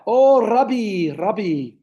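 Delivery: slow, drawn-out Afrikaner "Rabbi" said RUH-bee; Afrikaner-accented Rabbi. Retold or imitated. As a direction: imitate him savouring "Rabbi" as ruh-bee. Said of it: "I don't know why they can't say rabbi".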